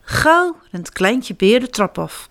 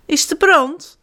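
mildly alarmed, higher question; half-awake fright about a fire; startled; 8 semitones higher than usual. Acted. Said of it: "vraagt mama Beer verschrikt". (mama beer, licht geschrokken)

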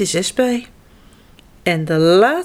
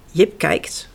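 ordinary delivery

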